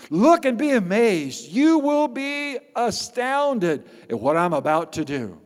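high, ringing promise of wonders; amazed proclamation. Acted. Pastor reading the Lord's promise to Habakkuk, amazed proclamation. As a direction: proclaim it with mounting awe.

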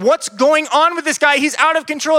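loud, high, hammed-up exasperation; overwhelmed outrage. Religious teachers unable to handle the boy, overwhelmed outrage. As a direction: act out teachers at their wits' end. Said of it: "they couldn't handle him".

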